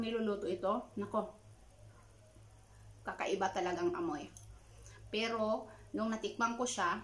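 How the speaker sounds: chewing while talking; eating from chopsticks; mouth full. Real, not acted. Seen 0: Bites fried tofu from chopsticks while speaking; food at lips throughout.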